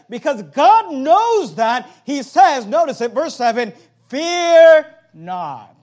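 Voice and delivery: slow, loud, high proclamation; booming sermon peak. Acted. Pastor commanding the church not to fear, loud.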